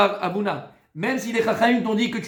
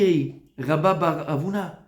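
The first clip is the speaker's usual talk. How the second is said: normal talking voice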